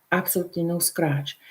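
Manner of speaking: normal talking voice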